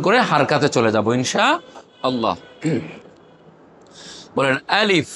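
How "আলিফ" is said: আলিফ said short, with no held vowel; no elongation. Shown as a demonstration. Teacher models আলিফ for students to repeat, no elongation. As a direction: say আলিফ crisply without stretching it. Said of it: "মাদ নাই".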